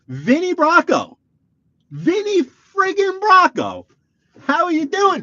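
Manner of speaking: very high, loud, stretched-out shout; excited hailing. Real, not acted. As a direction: holler a starstruck welcome. Hailing a celebrity entering the chat.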